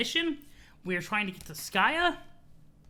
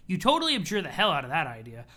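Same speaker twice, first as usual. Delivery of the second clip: usual speaking voice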